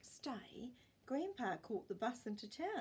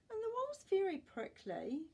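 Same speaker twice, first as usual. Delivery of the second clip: mild complaint; 11 semitones higher, 5 dB louder than usual. Acted. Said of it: "Grandpa complained".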